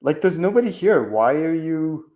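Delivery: puzzled talking-to aimed at himself; scolding himself aloud. Retold or imitated. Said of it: "I'll literally say it out loud".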